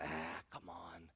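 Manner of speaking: very soft, low coaxing; hushed tempter's wheedling; cajoling enemy. Acted. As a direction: sweet-talk them quietly into giving in. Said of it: "The enemy's always cajoling".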